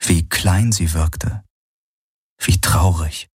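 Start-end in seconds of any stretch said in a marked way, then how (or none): none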